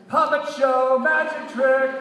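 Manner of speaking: high, loud sing-song scream. A narrator's reading. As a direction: shriek it out gleefully. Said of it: "And Brittany screams".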